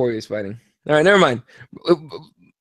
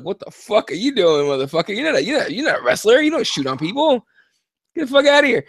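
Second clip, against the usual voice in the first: mock-heckle a fighter, higher as it goes; retold indignant scolding; 5 semitones higher than usual. Fan scolding the fighter who went for a takedown, indignant. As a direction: pitch jumping up in outrage.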